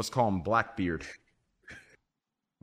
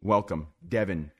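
ordinary talk, nothing marked

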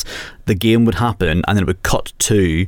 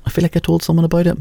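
repeated higher and quicker; higher, faster; 8 semitones higher, faster than usual. Shown as a demonstration. Repeats line in another voice.

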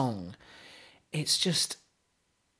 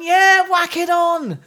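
excited; 12 semitones higher, 8 dB louder, slower than usual. Retold or imitated. His own imagined reply.

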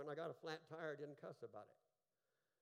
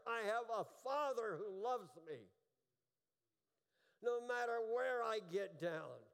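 preaching at full force; 5 semitones higher, 7 dB louder than usual. Acted. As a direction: proclaim it to the whole congregation.

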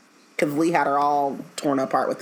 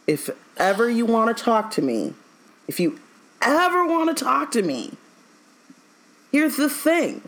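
imitating Sirius; 6 semitones higher than usual. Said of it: "Sirius said explicitly".

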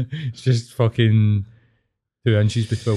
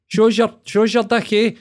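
high, loud mock Fife accent; Fifer accent; 10 semitones higher, 4 dB louder than usual. Shown as a demonstration. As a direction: put on a Fife accent, pitched way up. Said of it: "that's Fifer".

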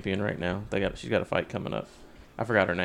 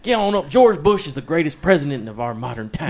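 angry Texas accent; 5 semitones higher, 7 dB louder than usual. Shown as a demonstration. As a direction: holler it like a mock-angry Texan. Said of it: "Texas is more, uh, angry".

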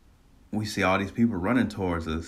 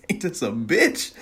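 high, drawn-out laughing exclamation; amused retold outburst; laughing disbelief; 6 dB louder than usual. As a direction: say it through a laugh, stretched out. Laughing at his own retort.

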